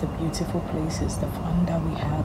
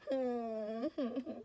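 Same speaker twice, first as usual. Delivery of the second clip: tearful laugh; 6 semitones higher, 13 dB quieter than usual. Real, not acted. Laugh-cry at departure.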